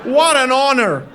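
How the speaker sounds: loud, drawn-out sarcastic awe; loud mock honor; mock gratitude. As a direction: gush it slowly, dripping with sarcasm. Mother's sarcastic thanks to father.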